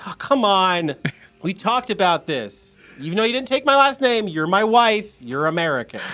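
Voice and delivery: husband protesting to his wife from the kitchen; pleading husband. Acted. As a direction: whine at the stubborn wife. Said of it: "the guy in the kitchen goes".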